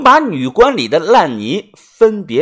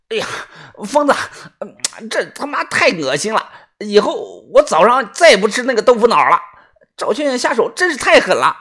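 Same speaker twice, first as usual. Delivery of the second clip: high-pitched sidekick grousing; disgusted grumbling; 5 semitones higher than usual. Acted. Sidekick grumbling through cloth at the mess, disgusted and high. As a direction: complain in a high voice as if sickened. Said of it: "豆芽仔用衣服捂着嘴，嘟囔的说道".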